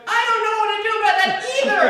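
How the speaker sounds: put-on high, loud, fast child's retort; a little girl yelling back at her counselor; indignant kid shout.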